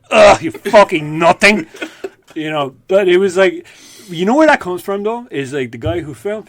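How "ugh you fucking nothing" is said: louder, slow scornful put-down; contemptuous insult. Retold or imitated. Host insults street strangers, slow scornful put-down. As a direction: dismiss a beggar with disdain. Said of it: "and I go".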